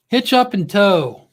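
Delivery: drawn-out welcome call; announcer call-out. Acted. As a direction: announce the channel like a host. Host calls out viewer's channel name.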